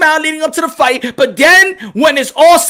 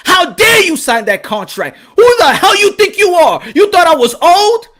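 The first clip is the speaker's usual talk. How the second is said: acted indignant challenge, pitch jumping up; Manny Pacquiao outraged; 3 semitones higher than usual. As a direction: scold the rival like an insulted champion. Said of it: "I'm speaking for Manny Pacquiao right now".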